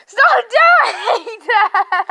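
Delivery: slow, loud laughing shout; laughing scold. Real, not acted. Scolding the dog kicking grass, laughing loudly. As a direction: drag it out through laughter.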